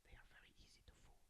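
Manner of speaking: fast conspiratorial whisper; whispered aside. Real, not acted. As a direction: murmur it quickly under your breath. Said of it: "whispered joke aside".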